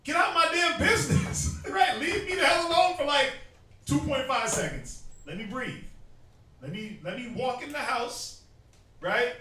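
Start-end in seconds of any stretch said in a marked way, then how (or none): 0.1-5.8 loud, high, laughing yell at the nagging; half-laughing blow-up at being crowded; loud, high fed-up man, laughing through it; fed-up shout. Retold or imitated. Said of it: "He's like".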